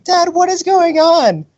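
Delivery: very high, slow mock wail; crying kid imitation. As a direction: imitate a tearful child pleading with dad. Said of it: "The kid was crying".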